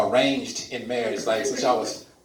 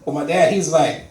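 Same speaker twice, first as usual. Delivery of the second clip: ordinary talk, nothing marked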